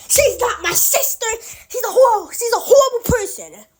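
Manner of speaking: mock outburst. Jessica voiced denying Queenie is sister.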